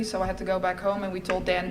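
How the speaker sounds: ordinary delivery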